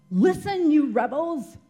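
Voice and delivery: high, loud retold yell. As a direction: yell it at the crowd. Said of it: "he's yelling at them".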